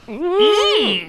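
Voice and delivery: high drawn-out "mm"; imitated high-pitched hum; monk's hum. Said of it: "one of the monks just kinda goes".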